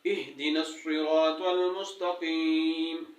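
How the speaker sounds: slow, drawn-out tajweed recitation; Quran recitation. Shown as a demonstration. Teacher reciting Fatiha to students, slow and measured. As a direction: recite each word slowly and carefully.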